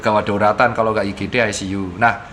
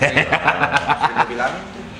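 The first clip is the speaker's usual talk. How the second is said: a brief awkward chuckle; short real laugh. Real, not acted. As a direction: laugh off the heavy moment. Laughs after grim dying-alone point. (tawa canggung singkat)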